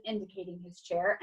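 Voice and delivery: ordinary delivery, nothing marked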